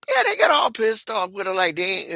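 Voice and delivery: ordinary delivery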